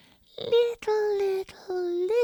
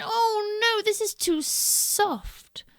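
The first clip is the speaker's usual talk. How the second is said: Goldilocks complaining; 13 semitones higher, slower than usual. Acted. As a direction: whine that the bed is too hard. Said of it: "and she went".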